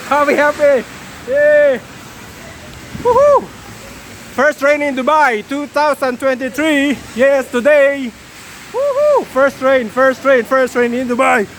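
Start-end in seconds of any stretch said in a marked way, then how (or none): 3.0-3.4 high, loud whoop; joyful whoop. Real, not acted. Celebrating the rain. 8.7-9.2 high whoop; joyful whoop. Real, not acted. Celebrating first rain.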